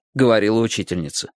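plain reading voice

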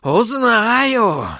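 high, drawn-out cry; vehement outburst. A narrator's reading. Sorcerer vowing to find out, crying out. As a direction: let the vow burst out high. Same vow repeated as outburst.

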